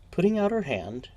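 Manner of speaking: ordinary delivery, nothing marked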